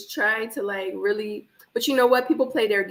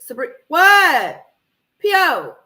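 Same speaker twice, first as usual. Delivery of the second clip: surprised; 7 semitones higher, 8 dB louder, slower than usual. Real, not acted. Reacting to the show.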